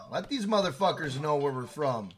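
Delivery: usual speaking voice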